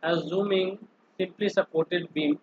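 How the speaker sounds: slow, measured dictation with a pause; dictating. Real, not acted. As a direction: spell out the line slowly while writing. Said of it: "एक line खाली लिख देंगे यहाँ पे". (लिखते हुए धीरे-धीरे बोलना)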